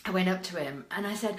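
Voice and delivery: ordinary delivery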